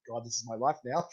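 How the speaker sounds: quiet defeated self-talk; resigned aside. Retold or imitated. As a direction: sigh it out in mock despair. Retells resigned reaction to being sprayed.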